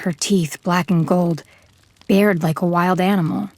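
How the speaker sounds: ordinary delivery, nothing marked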